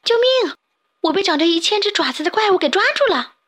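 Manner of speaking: trapped fish crying to his friend, frantic and fast; panicked cry. Acted. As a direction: gabble it out in terror. Fish caught by monster.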